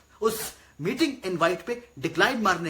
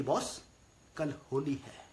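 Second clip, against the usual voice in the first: meek reminder; 5 semitones lower, 7 dB quieter than usual. Acted. Meekly reminding the boss of Holi.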